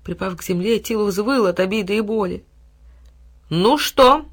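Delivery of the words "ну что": higher, louder lordly challenge; haughty. A narrator's reading. Boy lording it over the beaten dog, haughty. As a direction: lord it over him. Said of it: "свысока посмотрел".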